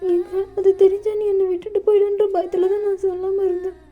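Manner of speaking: high, pleading voice with crying breaks; tearful young lover. Acted. JK pleads with Dev not to leave, high and tearful. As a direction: beg him not to leave you, close to sobbing.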